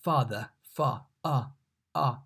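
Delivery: father, fa, a drawn out slowly; teacher drilling the vowel; open ah sound. Shown as a demonstration. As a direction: isolate the ah in father. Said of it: "pronounced like A in father".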